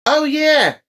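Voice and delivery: high, loud burst of delight; excited. Real, not acted. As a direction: yelp it out thrilled. Excited reaction.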